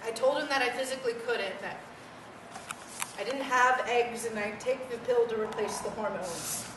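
ordinary talk, nothing marked

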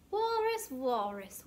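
ordinary delivery

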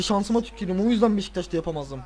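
normal talking voice

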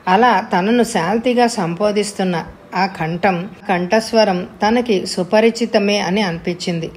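plain reading voice

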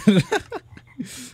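loud high-pitched laugh. Real, not acted. Gamer laughs after an item is snatched, high laugh. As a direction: burst out laughing.

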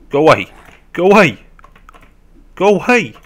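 loud, high barks of go away; shouting off attackers. Real, not acted. Player warding off zombies in a game, shouted. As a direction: yell it, rising with each repeat.

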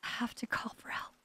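whispered, fading, short of strength; faint dying whisper; weak whisper. Acted. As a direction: breathe it out like you're bleeding out. Whispered after injury.

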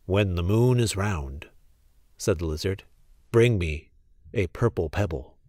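put-on a solemn old lizard, speaking softly; low mysterious lizard voice; magic lizard.

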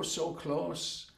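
ordinary delivery

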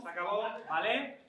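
ordinary talk, nothing marked

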